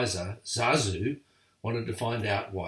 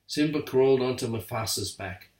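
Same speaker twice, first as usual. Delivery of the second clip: ordinary delivery, nothing marked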